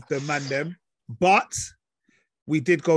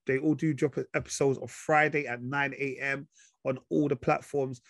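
ordinary talk, nothing marked

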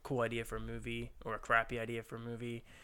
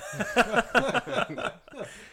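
high laugh; 12 semitones higher, 7 dB louder than usual. Real, not acted. Same genre twice joke.

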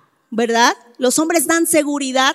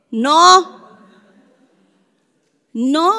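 normal talking voice

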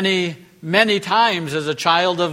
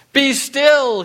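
higher, louder command to the storm; 8 semitones higher, 4 dB louder than usual. Acted. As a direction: order the waves to be still. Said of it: "he commands".

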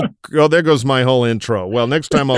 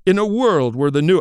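booming, higher movie-trailer voice; trailer voiceover; 6 semitones higher than usual. Shown as a demonstration. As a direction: announce it like a blockbuster trailer. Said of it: "I've always wanted to do a trailer voiceover".

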